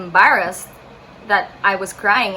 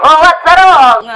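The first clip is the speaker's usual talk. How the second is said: exaggerated sing-song Italian accent, loud and high; drawn-out cartoon Italian accent; Mario; 7 semitones higher, 15 dB louder, much slower than usual. Italian passerby asking the crying tourist what's wrong, hammed-up Italian accent. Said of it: "I sound like Mario".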